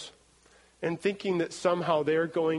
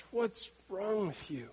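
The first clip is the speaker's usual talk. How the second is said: slow, much softer disbelieving question; incredulous God; 9 dB quieter, slower than usual. Retold or imitated. God questioning the idol-maker, quiet disbelief. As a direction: ask it softly and slowly, stunned. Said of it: "God's looking at this guy and says".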